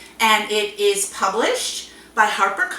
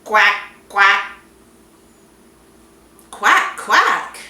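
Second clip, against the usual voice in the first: loud quacking; duck quack; 3 semitones lower, 7 dB louder, much slower than usual. Acted. Puppy George quacks for his mother, loud quacks. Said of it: "George went".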